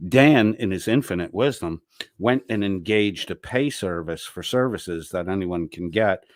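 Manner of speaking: ordinary delivery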